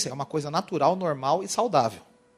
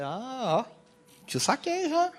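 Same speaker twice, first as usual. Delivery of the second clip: caught you; 7 semitones higher, 5 dB quieter, much slower than usual. Retold or imitated. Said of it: "Aí eu falei".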